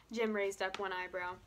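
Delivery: plain reading voice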